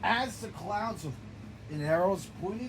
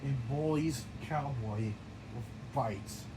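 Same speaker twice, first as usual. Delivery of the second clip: normal talking voice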